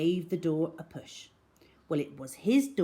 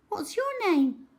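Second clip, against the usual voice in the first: put-on high, curious girl asking; little girl voice; 13 semitones higher than usual. A narrator's reading. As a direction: lift into the girl's voice. Cherry voiced in story.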